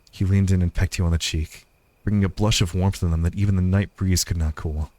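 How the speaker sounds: low, even storytelling voice; story narration. A narrator's reading.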